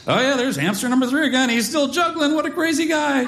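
high, strained storybook voice held up on one pitch; mock-cheery narration. Dad having to narrate every hamster to his toddler, high mock-cheery voice.

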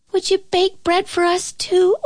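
put-on high, pleading little child; child asking. A narrator's reading. Child asks Sophie to bake bread, high child voice. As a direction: ask sweetly in a high child's voice. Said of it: "asked a child".

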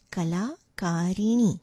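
"Kalakarini" said slowly with long "la" and "ka"; model pronunciation. Shown as a demonstration. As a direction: sound out "Kalakarini" unhurriedly. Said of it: "this is how you pronounce".

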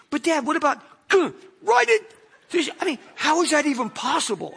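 put-on high girlish voice, jumping to a yelp on gah; daughter's voice. Daughter asks Dad about the math, high and girlish, yelping gah. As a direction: play the seventh-grade daughter asking again.

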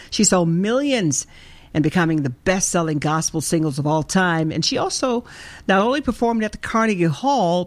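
normal talking voice

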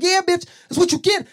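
high, loud hyped gloating. Retold or imitated. Gloating after he hit her, hyped up. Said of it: "I got hype".

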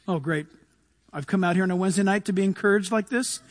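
low, sarcastic grumble; disgruntled churchgoer. Acted. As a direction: grumble it quickly under your breath. Voices a disappointed churchgoer.

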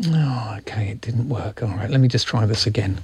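whispered aside. Real, not acted. Aside while technical problem.

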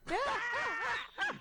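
high laugh. Real, not acted. Laughs at the dolphin clip.

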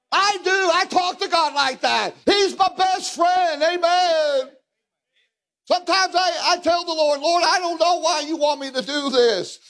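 high, shouted preacher's cry; fiery preaching. Real, not acted. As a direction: preach it at full force.

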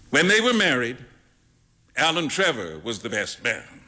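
usual speaking voice

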